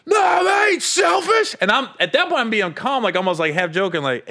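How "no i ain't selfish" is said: hammed-up defensive shout; retold yell. Friend yelling back over the food, high slow mock yell. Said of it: "you yelling at me".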